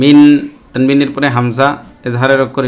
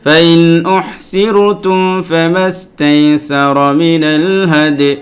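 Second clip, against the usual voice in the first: slow, melodic tilawat with long drawn vowels; very slow sung Quran recitation; 4 semitones higher, much slower than usual. Acted. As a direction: chant each phrase slowly, holding the vowels. Teacher recites the next verse.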